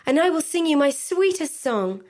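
high, lilting promise called out; pleading cry. A narrator's reading. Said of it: "she cried".

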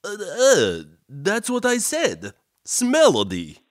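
lisping through big fangs; wearing vampire fangs. Acted. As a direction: talk around oversized fangs. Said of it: "it's my fangs. They're too big for my mouth".

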